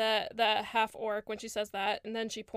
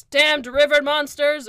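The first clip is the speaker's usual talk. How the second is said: loud, higher-pitched angry curse; angry half-orc; 4 semitones higher, 11 dB louder than usual. Acted. Half-orc cursing before burying his axe, angry outburst. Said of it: "out of anger".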